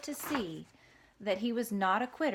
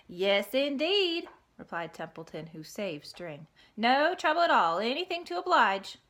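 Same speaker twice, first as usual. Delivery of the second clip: Templeton the rat; 3 semitones higher, 6 dB louder than usual. Acted. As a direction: sound slyly accommodating.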